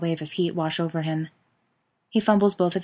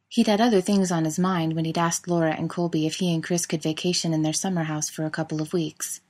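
usual speaking voice

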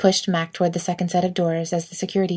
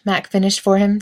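usual speaking voice

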